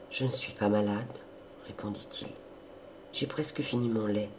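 soft, subdued priest's voice; quiet reassurance. A narrator's reading. Frail priest reassuring his sister, quiet and subdued. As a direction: answer gently, barely pressing it.

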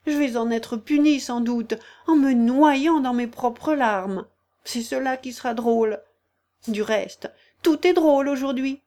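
put-on a young girl dismayed at her own tears; Alice, lightly fretful. A narrator's reading. Alice talks to herself, upset. (petite fille affolée, voix plus aiguë et plus forte)

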